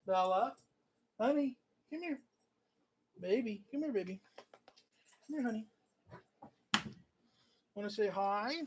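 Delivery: soft, coaxing call to the dog; tender pet talk. Real, not acted. Owner coaxing shy dog Bella to say hi, sweet coaxing.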